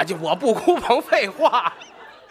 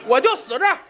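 high, fast, all in; a mock-heroic all-or-nothing vow; fired-up vow; 7 semitones higher, faster than usual. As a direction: blurt it out like staking everything. All-in comic vow, pitched high.

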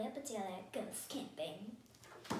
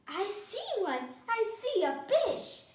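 high, rising storybook excitement, louder; excited; 7 semitones higher, 7 dB louder, slower than usual. A narrator's reading. Amelia Bedelia spotting a fish in the water, excited. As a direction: cry out on spotting something.

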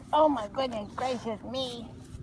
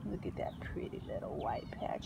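whisper; 9 semitones lower, 12 dB quieter than usual. Real, not acted. Admiring aside.

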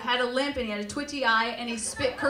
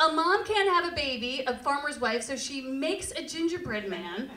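ordinary talk, nothing marked

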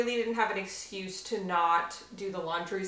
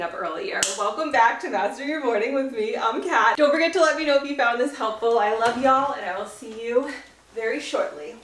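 upbeat, louder on-camera host read; bright host intro and sign-off; presenter voice; 2 semitones higher, 5 dB louder than usual. Acted. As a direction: sell it to the camera like a show host. Filming video intro.

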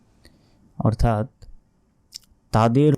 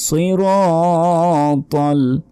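slow melodic chant, higher; Quran recitation; 6 semitones higher, 3 dB louder, much slower than usual. Shown as a demonstration. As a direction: begin the verse in recitation tune. Starts recitation again.

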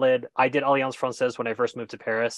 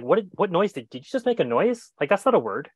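high-pitched, incredulous mock reaction; baffled disbelief; 6 semitones higher than usual. English-speaking brain reacting to French noise, acted bafflement. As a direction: play a bewildered brain querying a strange noise. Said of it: "your English-speaking brain is like".

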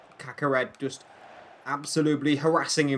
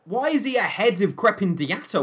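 baffled questions swooping up in pitch; exasperated disbelief; 5 semitones higher than usual. Real, not acted. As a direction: demand answers from your absent defender. Berates own defence after conceding.

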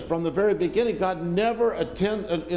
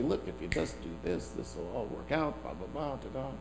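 hushed, dismissive mock advice, much softer; 4 semitones lower, 11 dB quieter than usual. Pastor mocking people who promise easy fixes, hushed and dismissive. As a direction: wave it off as empty promises.

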